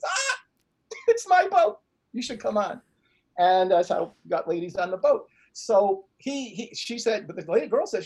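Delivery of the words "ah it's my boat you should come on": eager invitation. Retold or imitated. Friend calls to ladies on the dock, eager invitation. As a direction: call out proudly with a sudden high burst.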